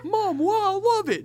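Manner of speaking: put-on high, loud whine of a child; a child begging his mom to keep the ringtone, whining high; whiny kid. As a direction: beg like a kid protesting. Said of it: "I was like".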